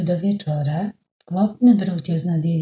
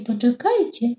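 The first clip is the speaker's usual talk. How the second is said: a repeated call, less high; calling again; 7 semitones higher than usual. Acted. Stork calls again to the flock, less high. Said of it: "zaczął wołać".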